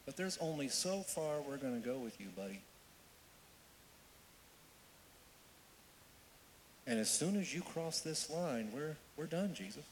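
soft, understated warning; church leaders' warning. Acted. Church leaders setting Jesus a limit, quietly warning. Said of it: "with the leaders of the church".

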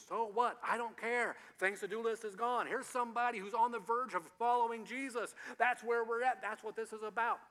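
fervent sermon climax; preaching at full force. Real, not acted. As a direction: drive it home to the congregation.

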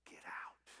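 low-key, hushed order; near-whispered, restrained command; quiet command. Retold or imitated. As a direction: give the order softly, with total authority. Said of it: "Jesus looked at the demons and said".

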